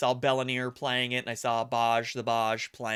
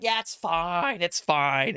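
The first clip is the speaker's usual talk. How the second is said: carefree, brushing off the warning; breezy dismissal; 3 semitones higher, 5 dB louder, slower than usual. Retold or imitated. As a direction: wave it off, it's totally fine. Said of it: "And I was like".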